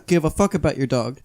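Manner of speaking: ordinary talk, nothing marked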